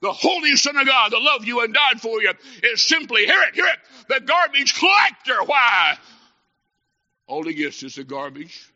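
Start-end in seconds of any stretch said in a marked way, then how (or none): 0.0-6.0 high, loud, impassioned pulpit cry; fervent preacher driving the point home loudly; full-force preaching. Real, not acted. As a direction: thunder it at the congregation, rising in pitch.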